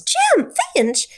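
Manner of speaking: sudden high-pitched shout of the name; yell. Retold or imitated. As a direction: call him out sharply across the crowd. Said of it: "she yells".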